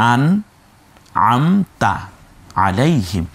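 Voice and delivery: আন, আমতা, আলাইহিম with long pauses between; model for repetition. Shown as a demonstration. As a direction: dictate আন আমতা আলাইহিম slowly, word by word. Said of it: "বলেন". (আন আমতা আলাইহিম থেমে থেমে বলে শেখানো)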